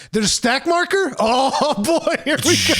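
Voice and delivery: high, giggling glee; gleeful excitement. Retold or imitated. Telling co-host about spamming Passage of Arms, high giggling glee. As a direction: laugh through it like a kid in a sweet shop.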